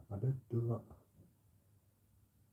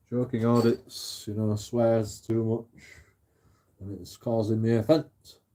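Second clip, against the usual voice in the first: normal talking voice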